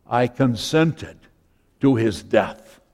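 low, soft, remorseful admission; acted haunted murmur; hushed confession. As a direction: confess it quietly, voice dropped right down. Voicing Saul's haunted confession.

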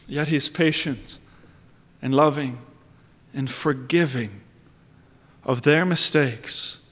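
tender hush. Real, not acted. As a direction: let it sink in gently, almost hushed. Softening on God's patience.

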